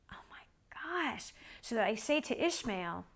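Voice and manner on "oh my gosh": slow, breath-only whisper of disbelief; stunned whisper. Retold or imitated. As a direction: whisper it slowly, aghast. Stunned reaction to the answer.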